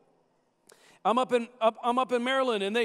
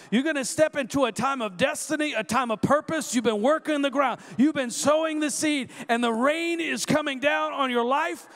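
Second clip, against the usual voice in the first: rising prophetic preaching, fuller voice; 3 semitones higher than usual. Acted. Preacher prophesying rain and harvest over the congregation, preaching. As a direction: declare blessing over the room like a prophet.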